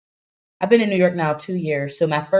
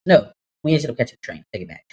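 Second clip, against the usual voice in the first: ordinary talk, nothing marked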